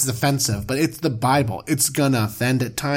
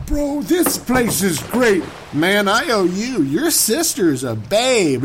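stoked bro; 4 semitones higher than usual. Pretend. Abram thanking Pharaoh.

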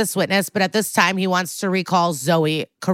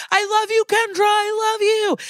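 screaming; 14 semitones higher than usual. Retold or imitated. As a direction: shriek it out like a lovesick madman. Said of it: "and screams".

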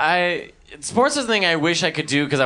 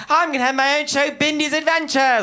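put-on high, grand plummy child star; imperious plummy grande dame; 13 semitones higher than usual. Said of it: "you're like".

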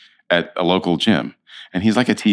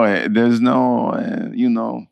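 put-on Israeli accent; 5 semitones higher, slower than usual. Slipping into Israeli Avram voice.

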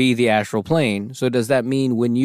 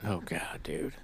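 whispering; 6 semitones lower, 16 dB quieter than usual. Real, not acted. Reacting to scary story.

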